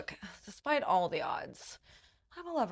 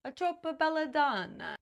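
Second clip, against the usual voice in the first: hammed-up Italian accent, pitched high; badly faked Italian accent with a lilting Belladonna; terrible Italian accent; 8 semitones higher than usual. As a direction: put on a bad Italian accent. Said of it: "doing a terrible Italian accent".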